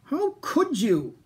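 high, slow upset cry; yelling. Acted. Iguanodon cries out at the Minosaur, high and slow. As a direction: accuse him of wrecking it. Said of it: "yelled Iguanodon".

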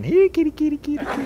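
high, sing-song kitty calling through laughter; big brother teasing Fuzzy by calling the kittens, high and laughing; teasing cat call. Retold or imitated.

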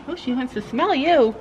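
normal talking voice

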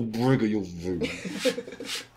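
very slow gravel growl, laughing; low gravel vigilante growl. Acted. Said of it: "We do gravel voice".

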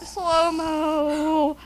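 long, stretched-out syllables; slow motion. Acted. Narrating their own slow-motion moment, drawn-out slow motion. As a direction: drag it out like slowed-down film. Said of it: "I should've sped up in that slow-mo".

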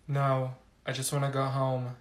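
ordinary delivery

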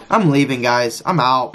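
ordinary talk, nothing marked

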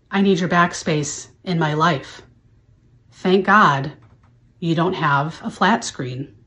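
ordinary delivery

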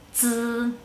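"z" as a held, buzzing dz with no puff of air; Mandarin Z. Shown as a demonstration. Teacher showing learners how Z sounds alone, "z" as a vibrating dz. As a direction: say "z" like the end of English kids, drawn out. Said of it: "hay una pequeña vibración".

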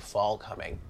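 normal talking voice

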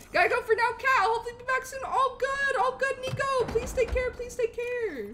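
high, excited send-off; effusive farewell. Real, not acted. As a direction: call out goodbye in a high, eager voice. Calling farewell to departing viewer.

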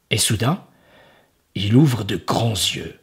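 ordinary delivery

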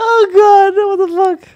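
high, loud shocked cry; shocked outburst. Real, not acted. Streamer reacting to a shocking clip, loud shocked cry. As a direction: recoil at what's on screen.

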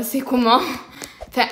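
ordinary delivery

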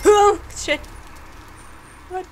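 high, loud yelp of shock; real startled outburst. Real, not acted. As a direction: jump out of your skin. Startled in horror game.

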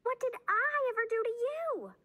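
very high, indignant yelp; yelped protest. A narrator's reading. Bean learns she was the spell's target, high yelped protest. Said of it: "yelped Bean".

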